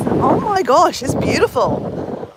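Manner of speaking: real thrilled exclamation, stretched out; awed delight. Real, not acted. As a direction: gush over the view above the wind. Arriving at windy seafront.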